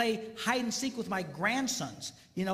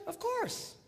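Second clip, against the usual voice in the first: ordinary talk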